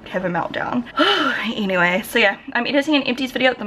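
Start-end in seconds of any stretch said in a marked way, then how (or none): 0.9-1.5 big audible exhale; heavy sigh. Real, not acted. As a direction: let out a high, breathy sigh. Sighs after retelling the scare.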